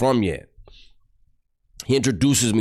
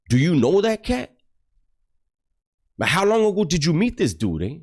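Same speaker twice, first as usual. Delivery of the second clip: pitched well higher, pressing him with disbelief; an exasperated challenge, voice jumping up; retold incredulous demand; 9 semitones higher than usual. Confronting him after pulling aside.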